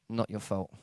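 low, soft reassurance; gentle reassurance. Retold or imitated. Therapist to troubled kid, gently reassuring. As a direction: drop low and quiet to reassure him.

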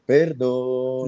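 slow drawn-out mock plea; pleading apology. Imitating a sorry boyfriend begging forgiveness. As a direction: beg forgiveness, stretching it out.

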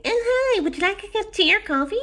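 very high, put-on service voice; mock sweet hostess, pitched right up; fawning air hostess. Air hostess offering pilots tea or coffee, mock fawning.

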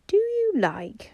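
high, slow, hesitant opening; doubtful. A narrator's reading. Bobby uneasily asks the others, high and doubtful. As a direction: let the question hang unsure.

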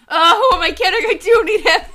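high, loud wail breaking into laughter; mock anguish, loud and laughing; melodramatic outburst. A narrator's reading. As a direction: ham up the lovesick despair. Hammed-up heartbreak, breaking into laughter.

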